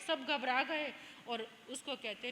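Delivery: ordinary delivery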